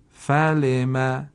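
slow, drawn-out word; slow model reading. Shown as a demonstration. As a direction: say it slowly for learners. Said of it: "کلمهٔ بعدی".

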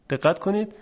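usual speaking voice

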